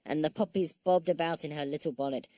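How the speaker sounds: usual speaking voice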